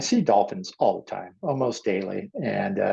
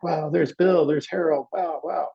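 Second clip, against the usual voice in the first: high-pitched, wondering wows; reenacted happy astonishment; amazed recognition; 7 semitones higher than usual. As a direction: spot old friends in amazement. Amazed at seeing passed carpenters.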